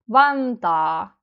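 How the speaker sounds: "Vantaa" with a drawn-out final "aa"; VAN stressed, long "taa"; long unstressed vowel. Shown as a demonstration. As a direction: hold the end of "Vantaa" without stressing it. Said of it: "say it long".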